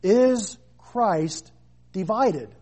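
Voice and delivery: very slow, weighty "Is Christ divided?"; slow rhetorical question. Acted. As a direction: let each word hang. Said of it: "Paul asks an important question".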